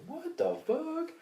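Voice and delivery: high, slow squeaky what the fuck; disbelieving high-pitched squeak; stunned disbelief. Retold or imitated. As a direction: pitch it way up in shock. Retold shock at teacher's request.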